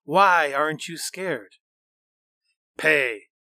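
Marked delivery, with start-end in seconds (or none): 2.8-3.3 a monster spitting with contempt; spat. Acted. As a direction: spit the word out angrily. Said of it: "the monster spat".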